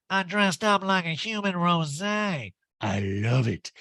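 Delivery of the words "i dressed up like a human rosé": slow, high drawl; Erica impression. Erica greeting the women, slow high put-on voice. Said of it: "Erica's like".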